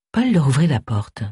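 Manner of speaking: usual speaking voice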